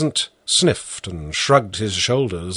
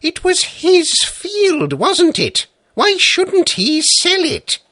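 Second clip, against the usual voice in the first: exasperated judge. A narrator's reading. Judge bursts out at the peasant, indignant and much higher. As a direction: throw up your hands in disbelief. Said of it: "burst out the judge".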